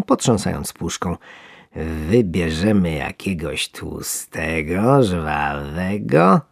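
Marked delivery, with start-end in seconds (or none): 2.1-6.4 slow, drawn-out relishing voice; gloating fisherman. Acted. Fisherman picks a fat worm with relish. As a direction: linger over each word.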